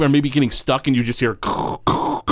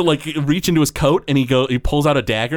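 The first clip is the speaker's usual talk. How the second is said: normal talking voice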